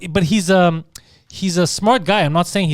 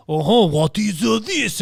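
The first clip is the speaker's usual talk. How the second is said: mock French accent; 4 semitones higher, slower than usual. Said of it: "the one that they talk about in the movies".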